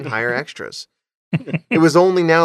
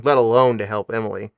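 ordinary delivery

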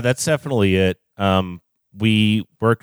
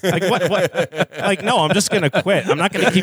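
high, laughing through the words; 10 semitones higher than usual. Real, not acted. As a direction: giggle while talking. Laughing at own joke.

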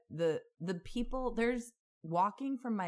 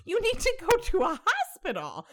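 much higher, louder, fast exasperation; exasperated outburst; 6 dB louder than usual. Retold or imitated. Retelling her answer to the angry bloody man, exasperated. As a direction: throw it back at him in disbelief. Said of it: "And I'm like".

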